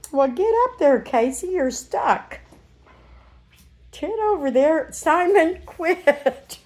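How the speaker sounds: high, sing-song pet voice; talking to puppies. Real, not acted. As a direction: coax the puppies along brightly.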